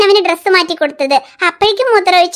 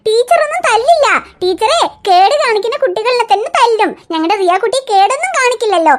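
usual speaking voice

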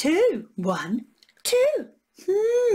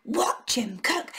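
ordinary delivery

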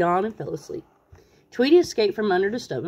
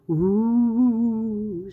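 long drawn ghostly "ooh"; spooky witch wail; 4 semitones higher, 3 dB louder than usual. A narrator's reading. Tweety Witch swooping over Sylvester, spooky wail. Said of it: "said Tweety Witch".

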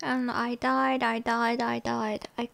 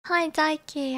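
normal talking voice

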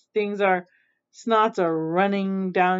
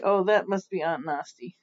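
ordinary delivery, nothing marked

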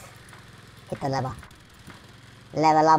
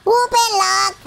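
high-pitched, insistent order; high command; 8 semitones higher than usual. Pretend. Player ordering the lock to open, high command.

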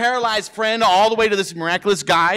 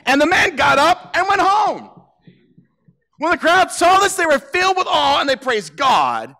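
much higher, louder, slowing down; climactic preaching; 10 semitones higher, 5 dB louder than usual. Acted. Preacher telling the congregation of the healing, much higher and louder. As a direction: swell to the miracle's climax.

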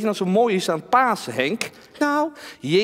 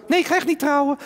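talking doll; 6 semitones higher, faster than usual. Acted. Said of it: "Henk is mijn pratende pop".

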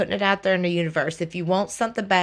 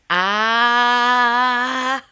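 long, loud high squeal breaking into laughter; drawn-out joyful shriek and laugh; excited squeal; 4 semitones higher, 3 dB louder than usual. Retold or imitated. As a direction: let out a rising fangirl scream, then cackle. Reaction to Christine watching her video.

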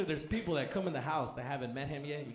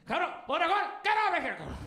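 angry dad; 11 semitones higher than usual. Retold or imitated. Dad home from work yelling at son, mock angry. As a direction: bark at your kid to come here. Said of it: "pissed off".

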